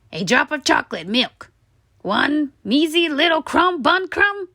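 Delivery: high begging tooth voice; pleading tooth. A narrator's reading. Tooth begs Stuart for sweets, pleading. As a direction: beg for scraps.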